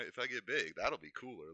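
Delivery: ordinary talk, nothing marked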